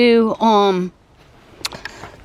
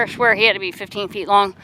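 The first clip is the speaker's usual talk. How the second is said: usual speaking voice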